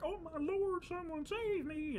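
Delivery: high, drawn-out cry for rescue; hammed-up damsel-in-distress squeal; mock panicked plea. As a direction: wail for help in exaggerated terror. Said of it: "And it's like".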